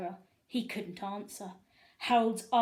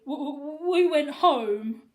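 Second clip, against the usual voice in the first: loud, slow, stuttering fear; nervous stammer; 5 semitones higher, 11 dB louder, slower than usual. A narrator's reading. Harold lying to the principal, nervous stammer. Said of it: "Harold stammered nervously".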